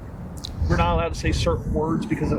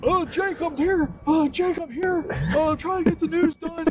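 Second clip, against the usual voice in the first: higher, hammed-up frantic war correspondent; war reporter; 11 semitones higher than usual. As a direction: play a panicked reporter in a war zone. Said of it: "I'm a C N N reporter".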